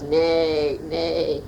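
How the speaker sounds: horse neigh. Shown as a demonstration. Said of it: "How does the horse go?".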